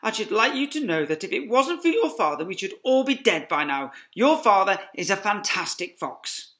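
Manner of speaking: proud Mrs Fox. Acted. Mother fox telling children about father, proud. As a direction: praise her husband warmly. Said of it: "said to her children".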